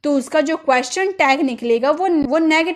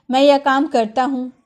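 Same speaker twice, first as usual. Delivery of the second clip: ordinary talk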